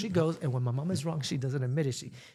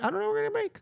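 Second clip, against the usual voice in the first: much higher, exasperated squeak; much faster than usual. Retold or imitated. Daughter fed up with her mom's answers. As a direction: pitch it way up in frustration. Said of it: "So I'm like".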